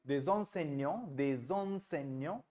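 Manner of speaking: slow, soft "des enseignants" with the liaison "dez"; teacher's correction. Shown as a demonstration.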